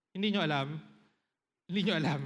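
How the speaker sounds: ordinary talk, nothing marked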